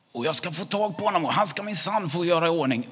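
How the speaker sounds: loud, high-pitched ranting; very angry. Acted. Angry neighbour threatens the boy before his dad, furious yelling. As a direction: shout the threat at full voice. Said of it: "han är jättearg".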